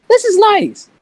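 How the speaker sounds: very high, loud gushing; mock delight. Boy enjoying girls' attention after a fight, hammed-up delight.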